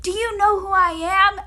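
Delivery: high, loud, slow indignant demand; arrogant demand. Retold or imitated. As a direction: draw it out with entitlement. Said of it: "And [name] was like".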